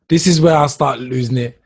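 ordinary delivery

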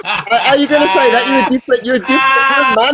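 high, loud laughing outburst; laughing disbelief. Real, not acted. Host laughing loudly at his friend's boast.

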